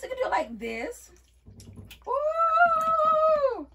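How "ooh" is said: long, high, loud "ooh"; delighted "ooh". Real, not acted. Showing viewers a new wig style, delighted ooh. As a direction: draw out a high whoop of delight.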